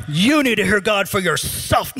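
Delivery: mock-preach it with a big swell; exaggerated little sermon. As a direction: overblown parental sermonizing. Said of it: "That wasn't quite like that, but sort of".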